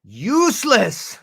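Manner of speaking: "Useless" stretched out, high and loud; big drawn-out "Useless", falling at the end; emphatic verdict. Acted. Repeats the verdict for effect.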